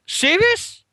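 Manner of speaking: squeaked high in disbelief; a stunned, high-pitched question; shocked disbelief. Retold or imitated. Retold disbelief at yellow kit.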